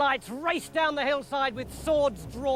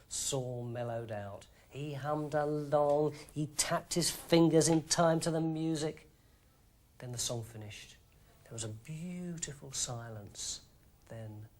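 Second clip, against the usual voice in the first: soft hush; 8 semitones lower, 6 dB quieter than usual. A narrator's reading. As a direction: lull the listener as the harp plays. Soothed by harp music.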